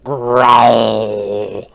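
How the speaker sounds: loud, drawn-out snarling growl on the word; loud, menacing beast growl; animal growl. Acted. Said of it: "So it's growling".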